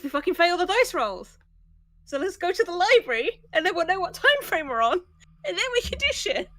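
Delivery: frustrated. Real, not acted. Frustrated player arguing plan.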